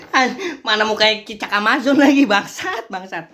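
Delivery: loud, high outburst rising on the curse; exasperated cursing. Real, not acted. Creator cursing the face in the video, loudly exasperated.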